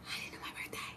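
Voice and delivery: breathless whispered disbelief. Real, not acted. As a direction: hush it like a stunned secret. Repeats the question whispered.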